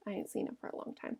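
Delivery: sad whisper. Acted. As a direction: breathe it out, sad and soft. Lenny sad, lost velvet.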